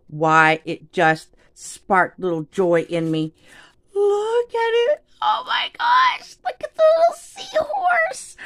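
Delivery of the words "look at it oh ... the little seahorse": squealing with delight, very high and strained; super high squeal. Real, not acted. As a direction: squeak it out in pure excitement over a cute seahorse. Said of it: "my voice just went, like, super high up there".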